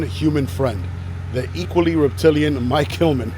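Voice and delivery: a touch of a showman announcer; lightly hammed-up reading of the exhibit sign; mock-grand reading. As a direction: read the sign like a grand proclamation. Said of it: "reading sign grandly".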